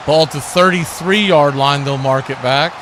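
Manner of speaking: ordinary talk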